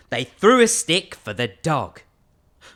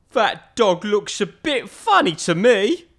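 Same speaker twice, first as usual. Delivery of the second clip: higher, louder and slow; doubtful big skeleton; 5 semitones higher, 5 dB louder, slower than usual. Acted. Big skeleton eyes the rebuilt dog, slow doubt. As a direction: muse on the odd-looking dog.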